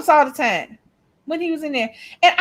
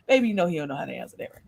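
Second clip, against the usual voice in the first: mock grandmother; 10 semitones lower, 7 dB quieter, much faster than usual. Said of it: "she'll leave out and be like".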